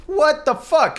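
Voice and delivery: high, loud shocked blurt. Retold or imitated. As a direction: blurt out in disbelief. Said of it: "And I'm like".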